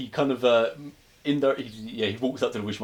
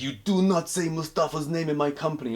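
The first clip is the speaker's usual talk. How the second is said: higher put-on Russian voice, indignant; mock Russian gangster; 7 semitones higher than usual. Pushkin scolding Krichkov, mock Russian gangster voice. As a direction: play the offended mob boss.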